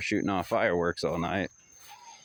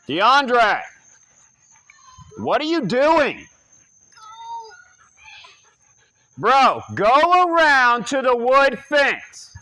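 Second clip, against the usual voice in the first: much higher and louder, slow drawn-out yell; yelling for his son; 10 dB louder, much slower than usual. Real, not acted. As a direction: call him across the yard, stretching the words.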